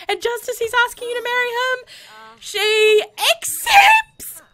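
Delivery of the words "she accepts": very high, slow cry into a shrieking laugh; joyful cry. Real, not acted. Gamer cries out as Poppy accepts, then shrieks with laughter. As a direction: let the joy break out.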